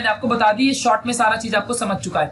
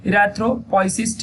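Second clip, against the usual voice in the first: slowly dictated term; slow term for notes; much slower than usual. Shown as a demonstration. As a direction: draw the word out syllable by syllable. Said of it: "वहां slow बोलना चाहिए".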